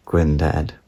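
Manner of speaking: usual speaking voice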